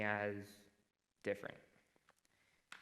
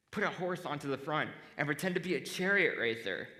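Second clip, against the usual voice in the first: higher, louder, enthusiastic idea-pitching; eager suggestion; 5 semitones higher, 6 dB louder than usual. Retold or imitated. Friend suggesting what to do with the wheelchair, enthusiastically. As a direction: act out someone keen on their costume brainwave. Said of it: "the ideas were".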